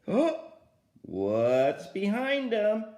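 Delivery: plain reading voice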